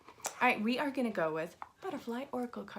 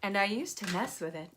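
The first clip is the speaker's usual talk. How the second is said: automated voicemail voice; 2 semitones higher, 3 dB louder than usual. Shown as a demonstration. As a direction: read it like a phone menu. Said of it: "I can sound like an automated voicemail system".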